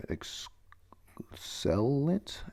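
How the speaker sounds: drawn-out, near-whispered attempt at "excellent"; sounding out. Real, not acted. Reader stumbling over a word in the note, sounding out. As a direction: slowly feel your way through "excellent".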